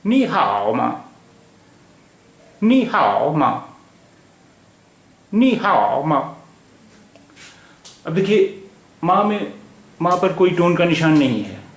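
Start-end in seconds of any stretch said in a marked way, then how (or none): 0.1-6.2 slow नी हाओ मा, toneless मा at the end; neutral-tone question particle. Shown as a demonstration. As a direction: model नी हाओ मा slowly with exaggerated tones. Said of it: "ये neutral tone है".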